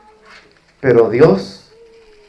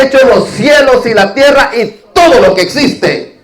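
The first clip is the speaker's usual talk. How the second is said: pleading demon; 7 semitones higher than usual. Retold or imitated. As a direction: play the demon begging Jesus, raised voice. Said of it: "este demonio le dice a Jesús". (el demonio le grita a Jesús, voz alta y suplicante)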